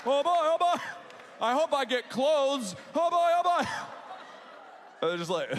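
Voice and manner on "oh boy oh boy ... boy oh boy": put-on high, loud, giddy child's voice; excited kid. Kids running down the stairs on Christmas, giddy. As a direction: squeal like a kid on Christmas morning.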